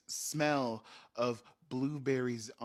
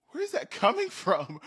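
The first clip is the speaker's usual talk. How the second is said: freaked out; 10 semitones higher, 7 dB louder than usual. Retold or imitated. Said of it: "they freaked out".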